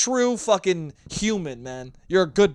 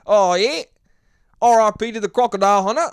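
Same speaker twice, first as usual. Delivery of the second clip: loud, higher, hammed-up Aussie accent; Australian accent; 5 semitones higher, 8 dB louder than usual. Shown as a demonstration. Podcaster salutes the crocodile hunter, loud put-on Australian accent. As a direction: push the mock Australian accent hard. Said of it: "I can do a fucking Australian accent".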